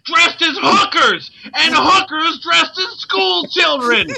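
high, slow, ranting cry; over-the-top shouting. Pretend. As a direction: bellow it like a street preacher. Reading a profile at full force.

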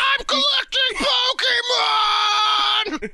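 shrieking high and loud; high shout. Acted. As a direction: scream it way up high. Friend shouts wild interjection.

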